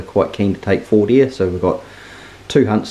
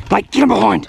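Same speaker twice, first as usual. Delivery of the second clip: high urgent shout across the hill; 13 semitones higher, 4 dB louder than usual. Real, not acted. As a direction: yell the instruction to your mate. Calling out during the shoot.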